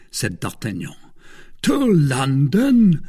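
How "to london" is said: loud, high, drawn-out question; astonished cry. A narrator's reading. Porthos to D'Artagnan on hearing the destination, astonished cry. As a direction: cry it out in slow disbelief. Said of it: "cried Porthos".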